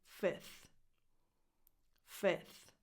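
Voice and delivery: "fifth" said as "fith"; simplified "fifth", short i straight into th; dropped F. Shown as a demonstration. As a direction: leave the f out before th in "fifth". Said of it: "drop the F before the T H".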